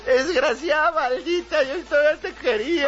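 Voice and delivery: high, hammed-up tearful lament; sob-shout at the one who left; drunken lovesick wailing. Drunk official lamenting to his ex, mock crying.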